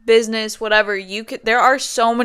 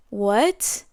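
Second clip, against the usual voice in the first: normal talking voice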